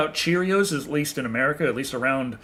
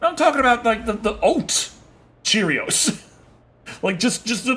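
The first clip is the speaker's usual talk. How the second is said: exasperated correction; 7 semitones higher than usual. Retold or imitated. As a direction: correct them, fed up. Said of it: "And I'm like".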